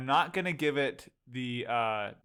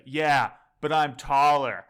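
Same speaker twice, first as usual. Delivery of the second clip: loud quoted line; 10 dB louder, slower than usual. Retold or imitated. Quoting movie line.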